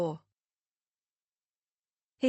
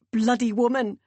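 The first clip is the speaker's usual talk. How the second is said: loud, higher burst of exasperation; exasperated fury; 4 semitones higher, 7 dB louder than usual. A narrator's reading. Meg cursing her dead mother in exasperated fury. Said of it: "impotent fury".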